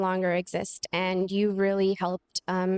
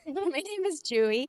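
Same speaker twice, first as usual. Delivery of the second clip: higher, through a small real laugh; laughing self-correction; 5 semitones higher than usual. Real, not acted. Speaker corrects her school name to Chewy, laughing. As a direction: laugh lightly as you give your name.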